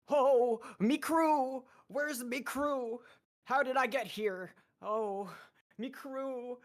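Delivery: high, loud, anguished pirate cry for his lost crew; old pirate captain calling out for his drowned crew; pirate captain wailing. Acted. Sunny wakes lost, crying for crew.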